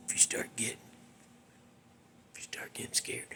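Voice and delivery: hushed, teasing ghost-story whisper; spooky whisper. Acted. Warning listeners to hug a pillow, whispered. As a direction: whisper it to make them shiver.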